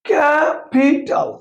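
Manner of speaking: ক্যাপিটাল slowly as ক্যা-পি-টাল; ক্যাপিটাল drawn out in three parts; split into syllables. Shown as a demonstration. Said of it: "তিনবার যেগুলো উচ্চারণ করা যায়".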